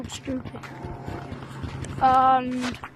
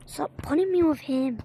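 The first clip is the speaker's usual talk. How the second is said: ordinary talk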